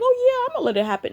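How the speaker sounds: high, fast, airy mock-casual voice; sarcastic high squeak of an indifferent God. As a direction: play a careless God brushing it off. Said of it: "God's just gonna sit back like".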